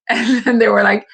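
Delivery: ordinary talk, nothing marked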